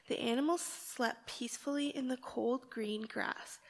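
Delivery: normal talking voice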